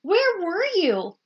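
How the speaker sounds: put-on eager kids. A narrator's reading.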